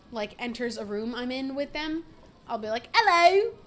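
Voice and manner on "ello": loud, high, hammed-up British hello; really bad British accent. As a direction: greet them in a deliberately awful British accent. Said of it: "really badly".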